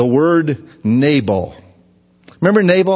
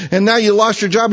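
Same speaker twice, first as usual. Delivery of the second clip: ordinary talk, nothing marked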